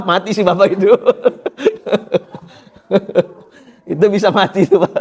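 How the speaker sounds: words spilling through laughter; laughing. Real, not acted. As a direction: laugh while telling the punchline.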